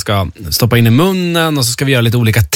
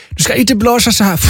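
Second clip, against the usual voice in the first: higher-pitched mock German accent; 9 semitones higher than usual. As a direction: lightly imitate a German woman showing the wrong way. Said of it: "hon pratade lite så här med tysk accent".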